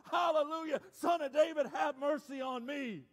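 loud, high plea to heaven. Acted. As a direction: cry out for mercy over the opposition. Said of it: "cry out all the louder".

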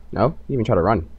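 normal talking voice

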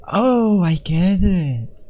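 airy, high, stretched-out "oh"; hammed-up realisation. Player puzzling over an object, hammed-up realisation. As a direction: draw out a whispery dawning realisation.

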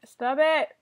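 loud sharp stop command; yell at dog. Real, not acted. Owner yells at barking dog Coco to stop.

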